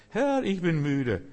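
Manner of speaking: langgezogener Ruf, hoch ansetzend und abfallend; müde Klage. Acted. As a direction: Gott gedehnt die Müdigkeit klagen. Gespieltes erschöpftes Klagegebet. (gedehnt klagend)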